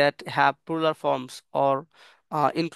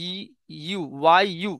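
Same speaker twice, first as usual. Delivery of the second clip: normal talking voice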